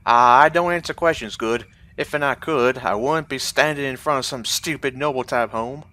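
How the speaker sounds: loud, gruff Southern dock guard; thug southern accent. Acted. Estate guard shooing off a noble, loud Southern accent. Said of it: "for my southern accent".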